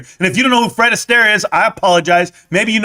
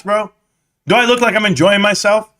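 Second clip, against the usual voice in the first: ordinary talk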